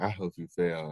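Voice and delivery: muttered low and soft under the breath; imitating a teacher's spiteful mumble; mumbled. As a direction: mumble it so only he hears. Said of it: "she had mumbled".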